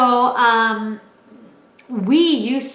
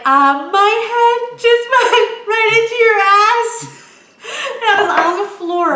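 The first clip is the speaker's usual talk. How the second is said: high, loud, slow words through laughter; laughing; 12 semitones higher, 8 dB louder than usual. Real, not acted. Telling the listener about the fall, laughing high and loud. Laughing hard mid-story.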